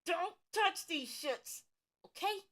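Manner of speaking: slow, high warning like a mom; a hint of a nagging mom; motherly scolding. Real, not acted. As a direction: scold them like their mom would. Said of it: "I'm having to be your mom".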